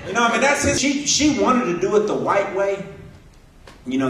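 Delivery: ordinary talk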